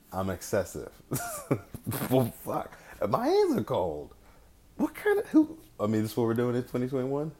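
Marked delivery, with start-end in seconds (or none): 1.1-2.0 a sudden high burst of laughter; high disbelieving laugh. Real, not acted. Laughing at being glove shamed. 2.1-5.6 higher, slower, exasperated complaint; fed-up, drawn-out protest. Real, not acted. Exasperated at being glove shamed.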